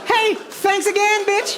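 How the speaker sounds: mock thanks. Mock reply to the praying woman.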